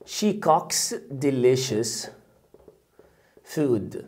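very slow and soft, word by word; slow model sentence. Shown as a demonstration. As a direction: dictate it gently to the learner. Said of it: "teacher models sentence slowly". (نطق بطيء للجملة)

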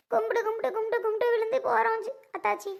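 high, hurried tearful lament; அழுகை. Acted. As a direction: sob out the plea in a rush. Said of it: "ஏன் அழுகுற". (அழுகையோடு வேகமான புலம்பல்)

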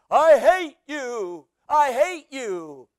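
drawn-out imitation of an echoing shout; imitated valley echo. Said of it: "heard the echo coming back".

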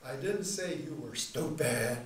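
slow, loud stupid; stupid drawn out slowly; stress on stupid. Shown as a demonstration. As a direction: drag out stupid. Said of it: "And then last of all".

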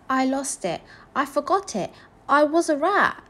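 put-on high-pitched child voice; little boy. A narrator's reading. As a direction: answer simply as a small boy. Little boy explains missing name.

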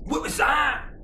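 very high, shrieking in fright; terrified shriek. Acted. Wee guy shrieking as something jumps out the bush. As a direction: scream it, scared out of his wits.

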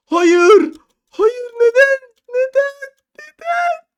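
high desperate wailing no and why; real howl of despair after falling; anguished scream. Real, not acted. Fell all the way down.

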